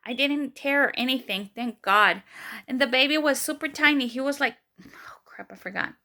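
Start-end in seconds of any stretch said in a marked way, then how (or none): 4.8-5.9 whispered self-reproach; hushed aside. Real, not acted. Mother forgetting the baby's weight, hushed aside. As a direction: drop to a whisper, as if to yourself.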